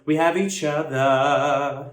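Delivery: We have each other sung slowly in a warmer, open tone. Shown as a demonstration. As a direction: sing it slow and warm, open vowels. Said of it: "more warmth onto it".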